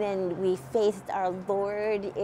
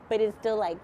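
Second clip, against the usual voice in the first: ordinary delivery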